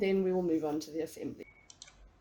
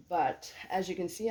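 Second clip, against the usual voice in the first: ordinary delivery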